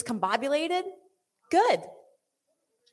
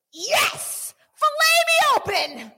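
much higher and louder, thrilled surrender; eager yes; 9 dB louder, slower than usual. Retold or imitated. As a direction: throw yourself open with delight. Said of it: "you just need to be like".

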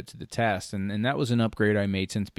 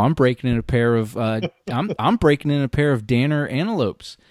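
ordinary talk

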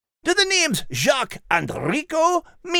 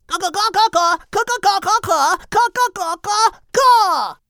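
rooster clucking; 9 semitones higher, slower than usual. Acted. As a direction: crow like a rooster trying to win. Rooster crowing contest.

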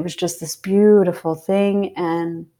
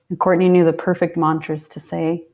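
normal talking voice

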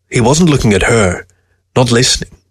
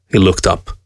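ordinary delivery, nothing marked

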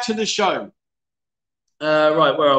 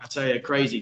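ordinary talk

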